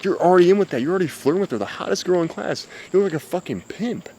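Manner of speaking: high-pitched playful ribbing; teasing. Acted. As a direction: tease with the pitch lifted. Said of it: "You start teasing her and".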